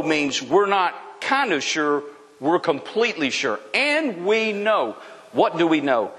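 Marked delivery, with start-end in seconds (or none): 3.7-5.0 slow, assured verse reading. Shown as a demonstration. Preacher repeating the verse to stress certainty, slowly. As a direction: draw the words out with certainty. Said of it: "Let me read it again slowly".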